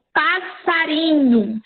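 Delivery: "passarinho" stretched out slowly, syllable by syllable; slow demonstration. Shown as a demonstration. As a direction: spell out "passarinho" slowly for the class. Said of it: "vamos supor". ("passarinho" dito bem devagar, sílaba por sílaba)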